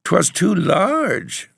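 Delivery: high, drawn-out whimper; whimpering child. A narrator's reading. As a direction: whine it in self-defence like a child. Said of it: "the child whimpered".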